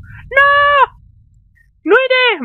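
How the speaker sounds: shrieked high and loud; scared girl refusing to leave; frightened refusal. Acted. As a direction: scream your refusal in panic. Voicing frightened Annie refusing.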